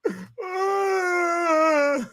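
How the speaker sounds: high laugh breaking into a shout; cracking up. Real, not acted. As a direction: crack up at your own bit. Laughing after impression.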